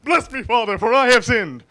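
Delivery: loud, dramatic confession; anguished penitent. Acted. Sinner in church confessing to the priest, loud anguished plea. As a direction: cry it out like a desperate sinner. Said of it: "go into church the next day and say".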